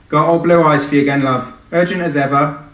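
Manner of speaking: cheerful warning about a difficult client; lightly voiced dispatcher. Dispatcher Joyce warns the pilot about his client, chummy.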